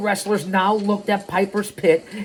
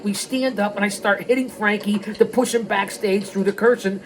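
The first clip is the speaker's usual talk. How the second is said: usual speaking voice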